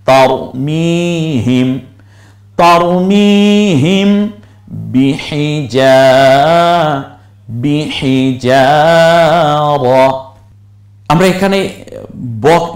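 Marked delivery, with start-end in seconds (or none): 0.0-9.8 slow melodic chant of the verse, drawn out; Quran recitation. Shown as a demonstration. Teacher chanting the verse for learners, melodic recitation. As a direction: chant each syllable long and sung.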